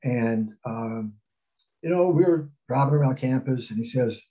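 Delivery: ordinary talk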